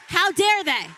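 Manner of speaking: loud, high second how dare they; outraged repeat. Real, not acted. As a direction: hammer it home again in anger. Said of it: "I was angry".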